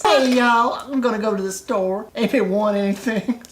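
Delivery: high, sassy and chirpy; sassy toy voice. Pretend. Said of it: "I imagine he talks like this".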